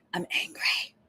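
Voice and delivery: whispered I'm angry. Shown as a demonstration. Host redoing her outburst quietly, whispered. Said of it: "that's a little loud".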